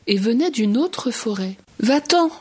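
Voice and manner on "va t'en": a sudden high, loud cry to chase him off; shouted. Acted. Little tyrannosaurus shouting at the stranger to go away, loud cry. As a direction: yell it out, higher and louder. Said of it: "lui cria le petit tyrannosaure".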